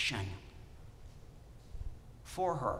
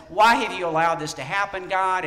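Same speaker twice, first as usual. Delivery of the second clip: louder, exasperated plea to God; mock upset complaint; 4 semitones higher, 9 dB louder than usual. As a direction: play Elijah as frustrated and accusing. Elijah's complaint to God acted.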